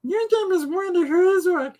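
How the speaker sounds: put-on very high sing-song baby voice; high cooing baby talk. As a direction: coo it like talking to an infant. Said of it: "in baby talk".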